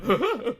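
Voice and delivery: high burst of laughter; loud laugh. Real, not acted. Laughing at own impression.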